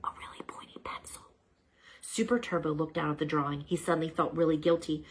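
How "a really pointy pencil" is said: hushed, breathless whisper; stunned whisper. Acted. Pet realizing the sharpener was his doing, whispering. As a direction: whisper it as the truth dawns.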